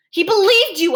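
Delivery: high, loud reproach; anguished accusation. A narrator's reading. Boy blames the doctor for Kevin's death, anguished. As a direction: hurl it as an accusation.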